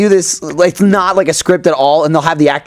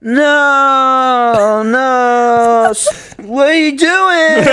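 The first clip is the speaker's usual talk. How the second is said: mock alarm; 9 semitones higher, much slower than usual. Real, not acted.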